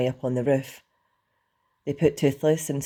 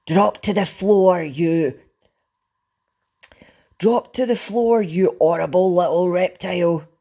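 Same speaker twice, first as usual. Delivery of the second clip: loud, raised, booming command; furious yell; 5 semitones higher, 7 dB louder than usual. A narrator's reading. Stoic commands Toothless down, thunderous yell. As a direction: roar the order at full force. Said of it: "yelled Stoic".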